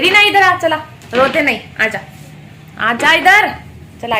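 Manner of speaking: loud coaxing call; calling over. Real, not acted. Vlogger calling crying coworker to come over, loud. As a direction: call her over firmly but kindly.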